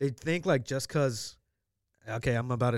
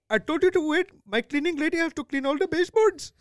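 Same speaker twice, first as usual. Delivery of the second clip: high-pitched mock Indian-accented complaint; imitating an irked Indian-accented homeowner; heavy Indian accent; 4 dB louder than usual. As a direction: scold in a put-on Indian accent. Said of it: "he had a mega seven eleven accent".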